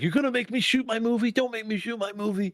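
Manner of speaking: high, pleading, overdramatic plea; pleading Tom impression. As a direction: beg like he's being forced to choose. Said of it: "He's like".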